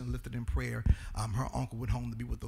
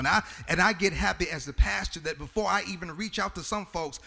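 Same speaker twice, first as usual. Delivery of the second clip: rising into a sung, whooping preach; preacher lifting into song over the organ; 6 semitones higher, 9 dB louder than usual. Real, not acted. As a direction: carry it up and loud like a church climax.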